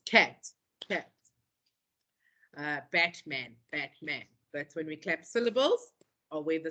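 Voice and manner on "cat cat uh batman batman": each syllable split apart, like clapping. Shown as a demonstration. Teacher showing grade one clapping, syllable by syllable. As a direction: beat out the syllables, softly. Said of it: "we said clap the syllables".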